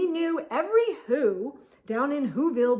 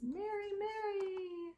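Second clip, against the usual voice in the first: soft call; 8 semitones higher, 12 dB quieter than usual. Real, not acted. Soft called name aside.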